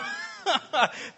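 loud laugh. Real, not acted. Laughing at own joke.

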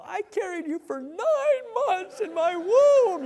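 high, hammed-up wailing mother, drawn out slow; mock-cry like a mom laying on the guilt; guilt-tripping mom. Preacher imitating a mom scolding her son in trouble, hammed-up wailing. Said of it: "one of those moms that when Lemuel got in trouble".

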